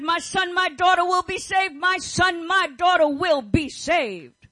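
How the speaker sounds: proclaiming over a son and daughter, loud; shouted declaration. Acted. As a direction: call it out with full conviction. Quoting a believer's declaration.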